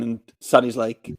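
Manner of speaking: ordinary delivery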